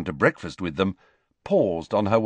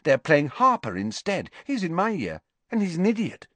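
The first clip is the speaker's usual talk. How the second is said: high, cheerful gossip; bright; 8 semitones higher than usual. Acted. Ginny tells Harry about the substitute, brightly. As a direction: chirp the good news about a weak rival. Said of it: "said Ginny brightly".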